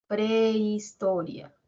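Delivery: prehistoria said slowly, each syllable spelled out; teacher spelling prehistoria slowly. Shown as a demonstration. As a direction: stretch out prehistoria for the class. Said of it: "si tenemos la palabra prehistoria".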